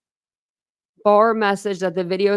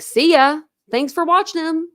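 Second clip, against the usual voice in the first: mock careless mother; 9 semitones higher, 5 dB louder than usual. Mocking the mother's indifference.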